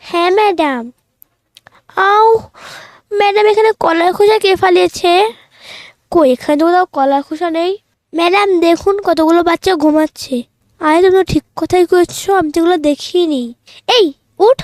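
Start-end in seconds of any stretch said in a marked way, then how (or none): 2.0-2.3 a short sharp yelp as she slips; ouch cry. Acted. Girl slipping on a banana peel in class, yelping ouch. 13.9-14.6 waking call. Acted. Teacher rousing a sleeping pupil, calling out sharply.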